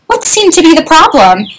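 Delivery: high, lightly voiced doctor; doctor asking. A narrator's reading. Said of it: "asked the doctor".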